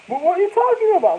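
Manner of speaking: high, loud mock wife; mock wife confused. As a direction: play the baffled wife. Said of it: "She said".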